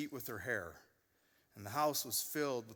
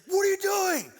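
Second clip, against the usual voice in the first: much higher, louder mock teenage outburst; teen son yelling; 10 dB louder than usual. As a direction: play the outraged sixteen-year-old. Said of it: "loses it on me".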